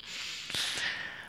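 quiet airy giggle; a little unvoiced laugh; soft breathy laugh. Soft laugh after invitation.

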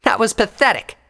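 loud scornful put-down; derisive. A narrator's reading. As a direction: sneer it at her. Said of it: "Pyre said derisively".

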